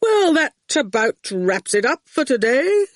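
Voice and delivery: unflustered lecturer wrapping up; a hint of a brisk don; lightly voiced professor. Dad voiced in story.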